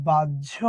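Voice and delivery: বাহ্য low and deliberate, as "baj-jho"; correct pronunciation demo. Shown as a demonstration. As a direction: model বাহ্য with a jjh sound for the cluster. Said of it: "এটার উচ্চারণ কি বলতো?". (শুদ্ধ উচ্চারণে বাহ্য, বাজ্ঝো)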